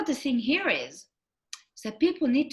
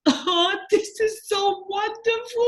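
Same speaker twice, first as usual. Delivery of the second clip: high gushing; 11 semitones higher, slower than usual. Retold or imitated. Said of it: "and I'm going".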